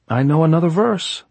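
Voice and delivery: high, loud, eager offer. A narrator's reading.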